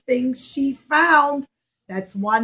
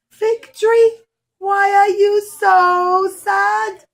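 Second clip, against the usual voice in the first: high, concerned call to the tree; worried question; 6 semitones higher, 5 dB louder, slower than usual. Acted. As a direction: ask the sad tree gently but urgently. Girl worried over the crying tree.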